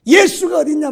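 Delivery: 높고 크게 따져 묻는 화난 사람 흉내; 화나서 붙들고 따지듯. Retold or imitated. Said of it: "너무 화가 나서". (화가 나서 따지는 말투 흉내)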